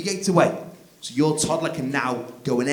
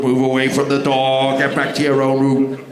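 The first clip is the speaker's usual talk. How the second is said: mock sniper command; 7 dB louder than usual. Sniper ordering kids back.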